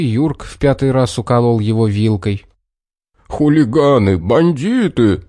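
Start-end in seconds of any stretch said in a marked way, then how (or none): 3.3-5.2 slow, indignant growl of insults; understated furious bear; mild parting bark. A narrator's reading. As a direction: shout it over the shoulder while fleeing. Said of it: "рявкнул напоследок медведь".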